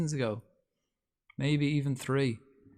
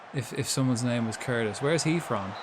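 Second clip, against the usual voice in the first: ordinary delivery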